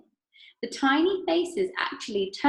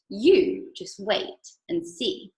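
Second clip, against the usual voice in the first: slow, louder put-off; Wonka teasing; 4 dB louder, much slower than usual. Acted. Mister Wonka teasing Veruca, slow and louder. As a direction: keep it secret, playfully.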